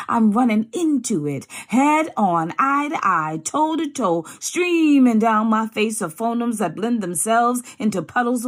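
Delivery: defiant poet building to full voice; building spoken word. Acted. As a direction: push it like a rallying cry. Poem builds to climax.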